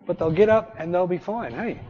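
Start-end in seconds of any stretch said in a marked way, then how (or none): none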